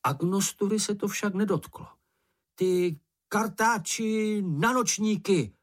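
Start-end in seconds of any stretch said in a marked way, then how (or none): 2.6-5.5 high, drawn-out angry taunt; hollered insult. A narrator's reading. Mumínek goading the bush, hollered insult. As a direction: fling the insult slowly in a high voice. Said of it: "pokračoval".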